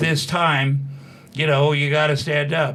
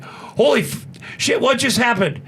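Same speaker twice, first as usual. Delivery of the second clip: high, startled mock panic; imitating alarmed manager; 9 semitones higher than usual. Manager calls Tom after the video, high and alarmed. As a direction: play the shocked manager on the phone.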